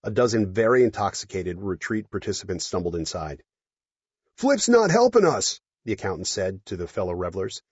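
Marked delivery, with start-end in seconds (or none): 4.4-5.6 put-on drunk guy voice. Drunk accountant speaking.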